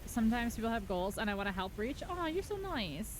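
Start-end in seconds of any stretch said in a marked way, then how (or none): 2.1-3.2 mock sweet.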